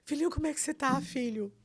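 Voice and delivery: high-pitched, anxious doting call; retold frightened mother. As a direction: re-enact a worried mother checking on her son. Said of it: "Eu, apavorada". (mãe aflita, voz aguda, revivida)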